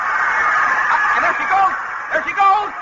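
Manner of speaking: excited shout. Acted. Announcer sees the swim start.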